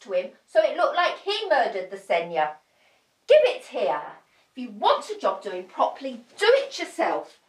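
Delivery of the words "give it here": ordinary talk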